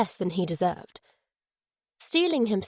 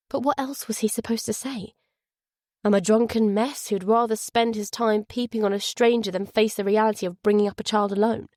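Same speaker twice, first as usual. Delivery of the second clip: plain reading voice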